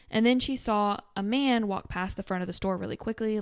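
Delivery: normal talking voice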